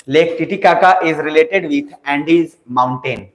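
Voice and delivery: very slow, measured note-giving; slow dictation. Real, not acted. Teacher asking students to write one line, slow dictation. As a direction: dictate word by word with gaps. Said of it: "मैं धीरे धीरे बोल रहा हूँ".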